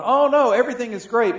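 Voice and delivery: mock cheerful denial. Imagined denial of the unbeliever.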